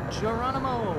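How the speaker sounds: high, loud jump cry; Geronimo shout. Real, not acted. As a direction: yell it as you leap. Jumping off, calling out.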